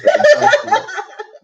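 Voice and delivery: loud high burst of laughter; high cackling laugh. Real, not acted. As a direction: cackle at the joke. Laughing at funny video story.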